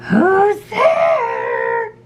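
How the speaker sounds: high, wavering mock-granny call; grandmother voice. A narrator's reading. Wolf posing as grandmother answers the knock, high wavering call. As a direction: call out like someone faking an old granny. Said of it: "in his best grandmother voice".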